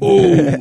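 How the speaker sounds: loud, high "ooh" whoop; high mocking whoop. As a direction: whoop it up in imitation. Imitating Denzel's whoop.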